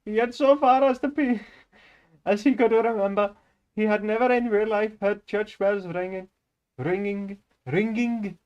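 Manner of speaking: put-on high, loud Indian-accented reading. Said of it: "I grew up with a lot of Indian immigrants".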